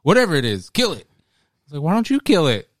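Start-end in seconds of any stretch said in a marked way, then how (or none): 0.0-1.1 high, louder frightened command; panicky order. Retold or imitated. Uncle ordering kid to kill. 1.8-2.7 higher, louder retort; indignant comeback. Retold or imitated. Kid talking back to uncle.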